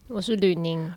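low, held-down self-introduction. Real, not acted. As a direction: push the voice down on purpose. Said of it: "讲我自己的名字的时候刻意压低声音".

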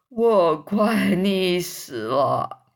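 slow, drawn-out drowning groan of words; drowning grasshopper. A narrator's reading. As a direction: drag each word out as if half-drowned. 蚱蜢吐水后说快淹死了. (快淹死似的拖长声音)

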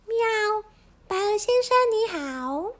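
very high meowing cat voice; meow, then greet in a high voice. Acted. Spotted cat greeting the goose, high-pitched.

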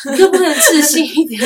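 high teasing laugh through words; laughing tease. Real, not acted. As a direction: laugh while teasing her. (笑着调侃)

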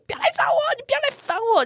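high, frantic plea to go away; frantic yell. Retold or imitated. Woman behind the door yelling at her stalker, frantic. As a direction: scream it like a madwoman at the door. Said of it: "因为我像疯子一样".

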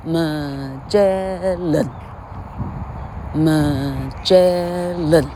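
Magellan stretched very slowly with the middle syllable lifted; slow stressed Magellan. Shown as a demonstration. Teacher slowly models how to stress Magellan. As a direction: draw Magellan out, leaning on -gel-. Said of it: "所以变，变成".

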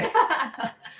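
a short, high real laugh; short amused laugh; burst of laughter. Real, not acted. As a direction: laugh out at the silly guess. Laughs at listener's guess.

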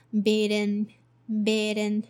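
"bidden" with B and in run together; linked bidden. Shown as a demonstration. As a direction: glue B onto in: "bidden". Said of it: "So link B and in together".

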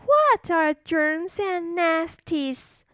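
put-on high, slow curious little girl; cartoon princess. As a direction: wonder aloud like a small princess. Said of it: "said the little princess".

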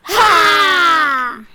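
loud high angry outburst "ha"; angry roar. Acted. Prince outdoing the puppy in anger, loud angry outburst. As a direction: fling it out in fury. Prince angrier than everyone.

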